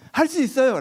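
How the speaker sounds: 높고 힘차게 할 수 있다 대답; 예수님께 자신 있게 대답하는 제자 흉내; 자신만만한 대답. Retold or imitated. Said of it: "라고 한 거죠". (높고 자신만만한 대답)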